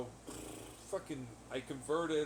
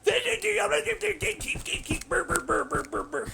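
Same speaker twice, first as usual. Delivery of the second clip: mocking babble; 14 semitones higher, 6 dB louder, slower than usual. Real, not acted.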